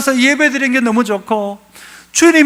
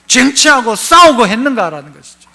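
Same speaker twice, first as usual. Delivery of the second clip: ordinary talk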